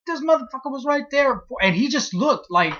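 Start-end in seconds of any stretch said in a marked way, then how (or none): 0.1-1.4 high, stunned exclamation; stunned disbelief. Retold or imitated. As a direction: pitch jumps way up in disbelief. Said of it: "I was like".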